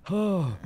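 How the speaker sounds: loud strained groan. Real, not acted. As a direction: push out an effortful groan. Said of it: "He's making old man noises".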